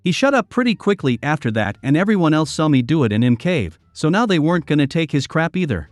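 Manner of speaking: usual speaking voice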